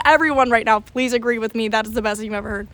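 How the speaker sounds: ordinary delivery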